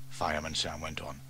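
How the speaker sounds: plain reading voice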